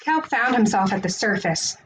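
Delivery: ordinary delivery, nothing marked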